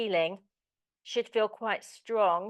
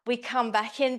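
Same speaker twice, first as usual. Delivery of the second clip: normal talking voice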